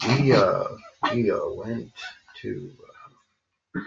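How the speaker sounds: dull, lifeless retelling; deliberately boring. Shown as a demonstration. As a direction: drag it out, bored. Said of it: "I'm trying to do more boring".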